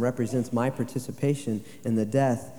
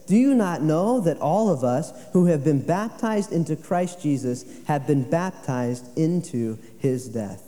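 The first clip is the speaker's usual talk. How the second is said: raised, louder scripture quote; forceful preaching; 4 semitones higher, 4 dB louder than usual. Acted. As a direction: drive the question home to the congregation. Quoting Paul, raised.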